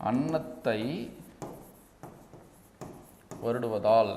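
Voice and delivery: phrase by phrase with long pauses; drawn-out words, waiting between them; slow dictation. Shown as a demonstration. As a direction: dictate it slowly for note-takers.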